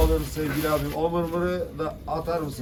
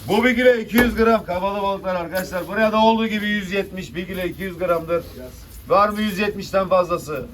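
loud auction call; auctioneer; 4 semitones higher, 5 dB louder than usual. Real, not acted. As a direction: call the lot to the room. Fish auction bidding call.

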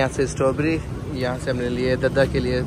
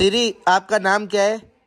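higher, slower, deliberate question; raised pitch, spaced-out question; voice-assistant query; 6 semitones higher than usual. Real, not acted. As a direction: ask slowly for a machine to catch. Asking the voice assistant.

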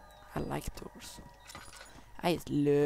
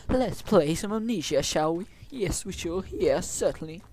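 playful, louder mock-British host; British accent; 9 dB louder than usual. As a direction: put on a prim British accent. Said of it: "I love British".

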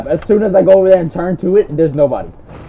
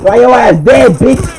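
loud taunt; 7 semitones higher, 7 dB louder, slower than usual. Real, not acted. Taunting dead enemy.

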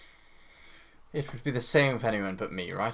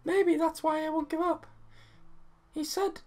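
put-on much higher young girl's voice; girl character voice. Gamer reading the girl's lines in a high voice.

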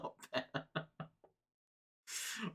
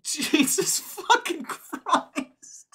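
high, loud and slow, laughing through it in disbelief; stunned laughing exclamation; 7 dB louder, much slower than usual. Real, not acted. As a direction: let it burst out in laughing shock.

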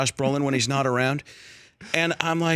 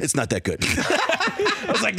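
laughing; 6 semitones higher, slower than usual. Retold or imitated. Evans teasing, laughing.

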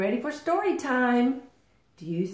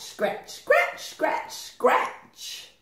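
scratching chant; 9 semitones higher, much slower than usual. Acted. Chicks scratching sound play.

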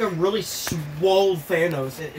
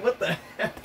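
ordinary talk, nothing marked

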